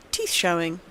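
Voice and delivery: usual speaking voice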